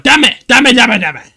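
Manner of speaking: hammed-up outraged shouting; over-the-top yell at the pen pal; mock furious yell. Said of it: "I was like".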